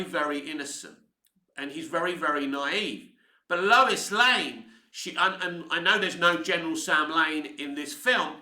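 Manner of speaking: normal talking voice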